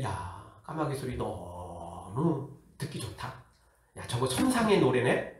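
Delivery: low, hushed mock admiration, drawn out; mock rapture. Preacher imitating an absurd admirer of crow song, mock rapture. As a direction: savour it slowly and softly, in pretend awe.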